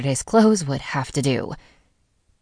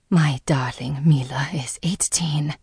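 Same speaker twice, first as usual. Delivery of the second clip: whispered. A narrator's reading. Mother whispering to her daughter on her birthday, hushed. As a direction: breathe it tenderly, barely voiced. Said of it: "Mom whispered".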